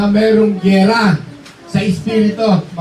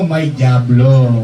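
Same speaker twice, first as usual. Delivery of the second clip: ominous warning; 7 semitones lower, 5 dB louder, much slower than usual. Acted. Preacher warning about the devil.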